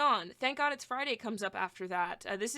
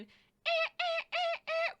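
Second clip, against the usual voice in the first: imitated high vocal yelps; slower than usual. Mimicking the vocal on the breakdown.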